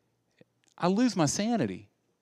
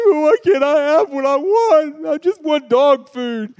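put-on high, loud, slow whining child with a sob in it; mock toddler crying for dog food, high and loud; whiny crying kid; 12 semitones higher, 10 dB louder, slower than usual. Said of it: "they're like".

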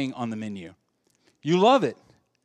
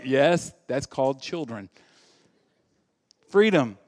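usual speaking voice

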